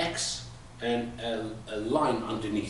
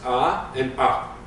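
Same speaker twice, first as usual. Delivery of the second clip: drawn-out "Ah", then a quick "ah"; long then short; 4 dB louder, slower than usual. Shown as a demonstration. Teacher showing the difference, long "Ah" then short "ah". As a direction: hold the first "Ah", cut the second short. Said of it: "You see the difference?".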